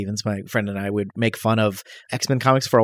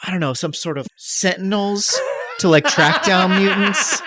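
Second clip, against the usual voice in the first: laughing; 8 semitones higher than usual. Real, not acted. Joking about sentinels, laughing.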